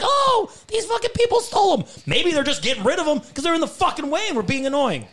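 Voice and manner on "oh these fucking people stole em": mock careless parent. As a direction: parody a parent who blames strangers, in a high shout. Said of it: "You're like".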